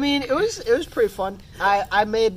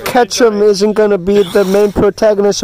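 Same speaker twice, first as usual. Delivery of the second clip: laughing; 10 dB louder than usual. Real, not acted. Laughing while telling.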